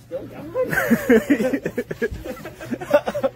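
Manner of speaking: high wheezy laugh; laughter. Real, not acted. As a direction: crack up in a long high giggle. Laughing after his manager bit.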